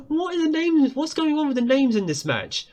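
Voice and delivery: high laughing incredulous question; amused high-pitched disbelief. Real, not acted. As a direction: giggle at the absurd names. Amused at odd player names.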